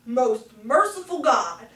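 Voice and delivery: loud, declaimed church prayer; solemn, projected liturgical prayer; recited confession. A narrator's reading. Congregational confession of sin recited.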